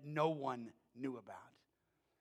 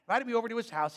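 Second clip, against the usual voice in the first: ordinary talk, nothing marked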